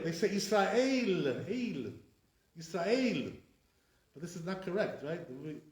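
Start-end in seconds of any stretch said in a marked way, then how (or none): 0.3-3.3 "Israel" ending in a gliding "ale"; "Israel" with the last vowel drawn into "eil". Shown as a demonstration. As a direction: say "Israel" as yis-ra-EIL, with an ay diphthong. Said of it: "How do they say Israel?".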